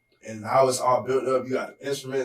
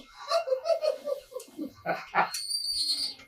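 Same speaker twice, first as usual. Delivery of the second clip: high-pitched burst of laughter; high giggle. Real, not acted. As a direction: cackle high and helplessly. Laughing at his mouth mishap.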